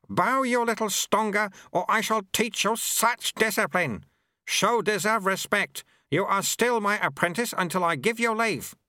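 put-on high, scolding old monk; stern old master. A narrator's reading. Lutze scolds his apprentice, stern old master voice. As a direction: lay down discipline to the boy.

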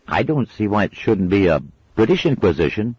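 mild indignant schoolboy; grumbling boy. A narrator's reading. Brian complaining.

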